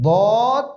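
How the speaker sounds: দদ heavy, full and drawn out; thick দদ. Shown as a demonstration. Teacher showing the heavy letter, thick drawn-out দদ. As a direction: fill the mouth for a thick, long দদ. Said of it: "মোটা হবে".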